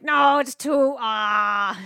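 hammed-up cry of embarrassment; mock cringing wail. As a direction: wail as if it's unbearable to watch. Reliving unbearable cringe while watching.

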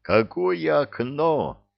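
slow, high-pitched amazement; aghast, drawn-out question; astonished gasp. A narrator's reading. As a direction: stretch the question in disbelief. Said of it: "ахнул Соловей".

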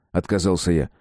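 usual speaking voice

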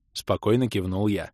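ordinary delivery, nothing marked

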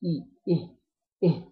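إيه started on a clear glottal stop and ee vowel; hamza with kasra. Shown as a demonstration. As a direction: say إيه with a distinct i after the hamza. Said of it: "ونعتبر إن تحتها كسرة". (إيه بهمزة مكسورة واضحة)

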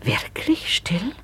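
slow, unvoiced whisper. Lady whispers doubtfully to José about the gate. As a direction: breathe it in hushed disbelief.

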